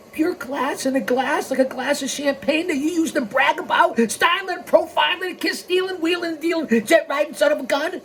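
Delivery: high, strutting recital of his brags; Flair catchphrase riff. Retold or imitated. Mocking Flair by listing his catchphrases. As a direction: rattle off the boasts like Flair on the mic.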